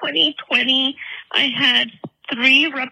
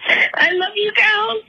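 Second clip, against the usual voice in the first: tearful thanks; 7 semitones higher than usual. Real, not acted. Emotional caller thanking hosts.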